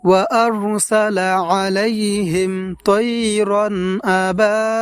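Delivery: melodic recitation, last word held long; Quran recitation. Shown as a demonstration. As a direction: chant the verse, stretching الفيل. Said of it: "এইভাবে পড়ব". (সুর করে তিলাওয়াত, শেষে টান)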